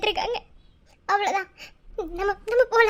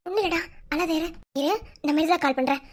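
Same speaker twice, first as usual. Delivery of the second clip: soothing; 7 semitones lower, 4 dB quieter, faster than usual. Acted. Friend soothing crying friend.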